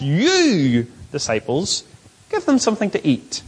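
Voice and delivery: "You" long and emphatic, then pauses; heavily underlined "You" addressed to disciples; stress on You. Shown as a demonstration. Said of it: "It's stressed".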